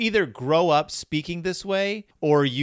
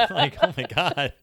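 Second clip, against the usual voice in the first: ordinary talk, nothing marked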